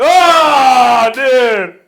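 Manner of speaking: high, very loud outcry; shouted reaction. Real, not acted. Reacting to a sibling's story about Mom, shouted outburst. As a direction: yell it out in disbelief.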